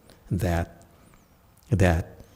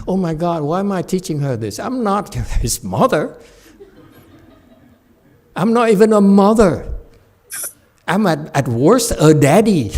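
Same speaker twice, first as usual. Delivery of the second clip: higher, laughing mock complaint; mock exasperation; 7 semitones higher, 4 dB louder than usual. Teacher joking about not being a mother, mock exasperated. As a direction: throw up your hands, amused. Monk mocking own exasperation.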